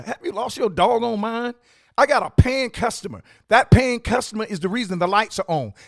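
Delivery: loud, high-pitched scolding rant; retold angry tirade. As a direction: hammer each point like a furious boss. Owner's retold tirade at worker.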